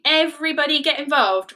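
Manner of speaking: ordinary delivery, nothing marked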